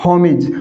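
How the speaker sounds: "होमिज" with short i ending; correct pronunciation. Shown as a demonstration. Teacher corrects students to "होमिज" with short i. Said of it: "क्या है?".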